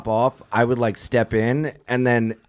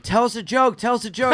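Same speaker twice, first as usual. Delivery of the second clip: put-on pestering kids; 8 semitones higher than usual. Students nagging for a joke.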